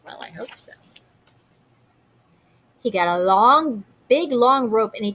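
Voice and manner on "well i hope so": soft doubtful whispered aside. Real, not acted. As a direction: murmur it under your breath to the class.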